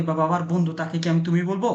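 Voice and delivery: ordinary talk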